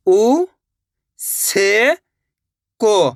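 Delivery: "उ ... से ... को", each drawn out with a pause after; letter by letter. Shown as a demonstration. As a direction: sound out "उ से को" one part at a time. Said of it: "तो मिलके बन जाएगा उसको". ("उ ... से ... को" अलग-अलग, खींचकर)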